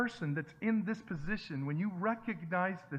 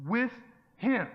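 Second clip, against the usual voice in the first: "him" punched much louder and higher; stress on him; 6 semitones higher, 8 dB louder than usual. Shown as a demonstration. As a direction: hit "him" hard, rising, as the point of the verse. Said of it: "notice who's with them".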